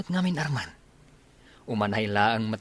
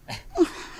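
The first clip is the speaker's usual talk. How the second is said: scoffing laugh. Acted. Scoffing at friend's remark.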